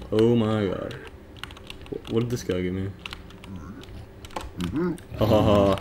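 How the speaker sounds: ordinary talk, nothing marked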